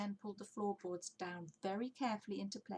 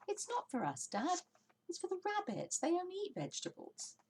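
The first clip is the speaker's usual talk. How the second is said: high, eager little voice; a small child piping up; small fox; 12 semitones higher than usual. Acted. Smallest fox calling to his dad, high and eager.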